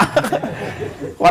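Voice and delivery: short breathy laugh; a quick laugh at his own joke. Real, not acted. Preacher laughing at his Javanese pilgrim story.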